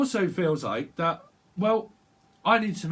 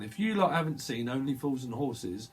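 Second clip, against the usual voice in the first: ordinary talk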